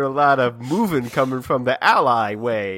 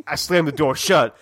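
ordinary delivery

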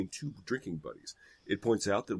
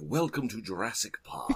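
slow movie-quote delivery; Jurassic Park welcome; 4 dB quieter than usual. Acted. Co-host offering another reading, slow movie-style welcome. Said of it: "more along the lines of".

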